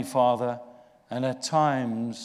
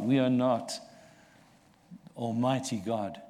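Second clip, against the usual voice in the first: ordinary delivery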